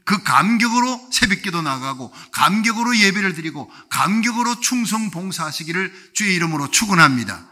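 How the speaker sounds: loud, fervent sermon climax; full-force preaching. Acted. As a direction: drive the congregation home with fervour.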